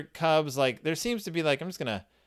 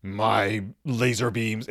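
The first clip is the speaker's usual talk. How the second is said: mock horse voice; 5 semitones lower, much slower than usual. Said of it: "It looks like the horse is like".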